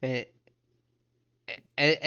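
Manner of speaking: usual speaking voice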